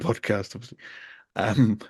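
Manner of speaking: ordinary talk, nothing marked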